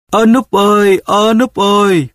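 high, slow wailing call; calling and crying. A narrator's reading. Mother searching for her son, calling and weeping. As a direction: wail his name slowly, high and tearful. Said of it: "vừa kêu vừa khóc".

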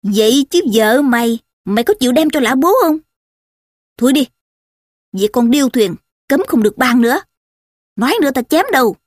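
forceful, performed telling-off; loud scolding. Acted. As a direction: scold and threaten to shut down the argument. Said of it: "mắng lớn".

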